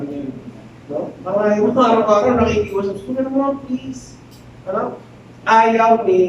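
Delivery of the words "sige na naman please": hushed, sultry begging; lightly voiced temptress whispering please; soft pleading. Potiphar's wife begging Joseph every day, soft sultry plea.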